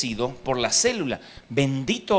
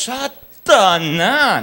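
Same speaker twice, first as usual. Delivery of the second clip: slow, drawn-out, louder Satanás; mock accusation; 4 semitones higher, 7 dB louder, much slower than usual. Outsider condemns local custom, slow drawn Satanás. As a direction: stretch out Satanás like a horrified judge. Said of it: "uno va ahí y diríamos".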